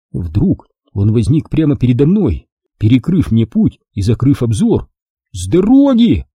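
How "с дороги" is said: alarmed shout. A narrator's reading. Jack warns Wilson blocking his path, alarmed shout. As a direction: shout a warning, pitched up. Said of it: "закричал я".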